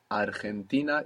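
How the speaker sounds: Argentina with a throaty Spanish j, ar-hen-TI-na; Spanish pronunciation. Shown as a demonstration. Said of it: "in Spanish".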